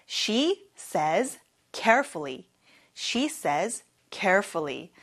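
very slow, every word clear; slow model sentence. Shown as a demonstration. Teacher showing students the sentence, very slow. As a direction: lay each word down for the class. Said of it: "같이 발음해 볼까요?".

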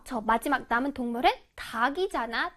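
ordinary talk

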